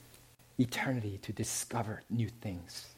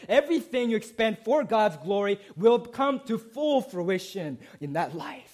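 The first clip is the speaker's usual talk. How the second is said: rising, louder sermon build; preaching at full force; 7 semitones higher, 6 dB louder than usual. Acted. Preacher rallying the congregation, building to a loud fervent peak.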